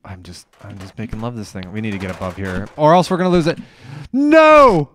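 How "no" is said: loud high shriek of no; real despairing shout; anguished yell. Real, not acted. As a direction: scream as it falls. Losing the item at last.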